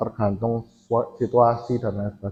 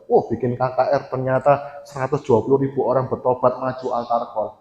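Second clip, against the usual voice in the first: normal talking voice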